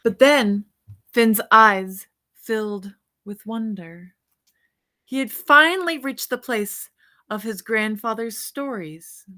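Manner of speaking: put-on bright, lively read-aloud for children; storybook reading. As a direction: read it like a bedtime picture book to kids. Reads picture book aloud.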